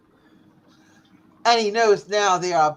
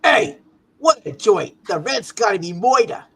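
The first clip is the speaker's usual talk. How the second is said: New York thug; 5 dB louder than usual. Acted. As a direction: play a Brooklyn goon. Thug character voice.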